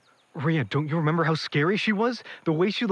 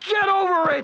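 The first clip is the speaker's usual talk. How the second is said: high, loud contemptuous yell; cruel shout; 11 semitones higher, 3 dB louder than usual. Acted. Dean taunting grieving Rhea, cruel shout. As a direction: throw it in her face.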